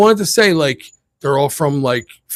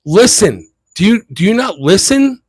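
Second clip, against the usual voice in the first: scolding; 3 semitones higher than usual. Retold or imitated. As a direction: tell off the pushy woman, voice raised. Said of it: "pushed her and was like".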